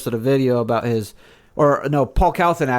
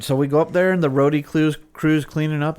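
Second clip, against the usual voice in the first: usual speaking voice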